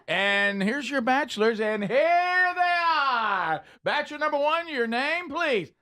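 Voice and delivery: high, slow showman announcing contestants; game show host voice. Pretend. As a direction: introduce the bachelors like a cheesy host. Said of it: "from The Dating Game".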